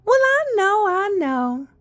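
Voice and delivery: high-pitched mother voice; imitated mother. Mother who knows her child, imitated high-pitched reply. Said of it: "She was like".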